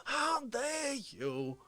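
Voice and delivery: high-pitched manic Fauci persona, mock outrage; Fauci character voice. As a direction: play the unhinged rival host. Streamer voicing Fauci character.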